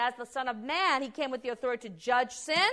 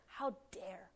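1 whispered outrage; 3 semitones lower, 12 dB quieter than usual. Retold or imitated.

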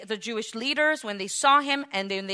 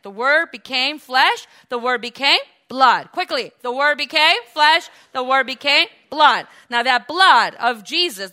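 loud, rising sermon repetition; forceful preaching; 3 semitones higher, 8 dB louder than usual. Real, not acted. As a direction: drive the point home to the congregation.

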